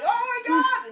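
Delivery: high, very loud retold shriek; imitated cry of horror; mock terrified scream. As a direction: scream it out in hammed-up terror. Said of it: "the guy's like".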